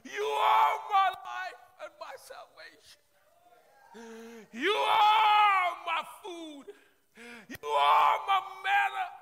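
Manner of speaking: high, loud, slow crying-out praise; full-force praise prayer. Acted. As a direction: pour it out to God at full voice.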